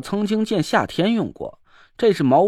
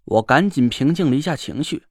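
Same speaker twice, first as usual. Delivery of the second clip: plain reading voice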